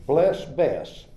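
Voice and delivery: ordinary delivery, nothing marked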